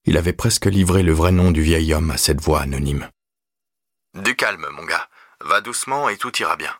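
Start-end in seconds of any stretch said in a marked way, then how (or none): 4.1-6.7 soft, slightly raised soothing voice; calm a panicking caller gently; quiet phone-line reassurance; reassuring operator. Acted.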